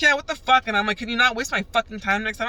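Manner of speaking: high, loud, indignant comeback; pissed off. Retold or imitated. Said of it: "I was fucking pissed".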